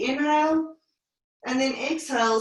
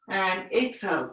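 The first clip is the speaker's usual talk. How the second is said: usual speaking voice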